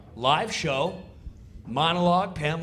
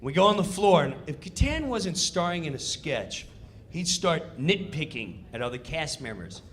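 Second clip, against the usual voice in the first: usual speaking voice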